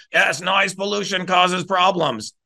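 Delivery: slow, stiff mock politician voice; lightly voiced sneering politician echoing a claim; mocking Kennedy. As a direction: drag it out like a smug politician parroting you. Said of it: "Booby fucking Kennedy says".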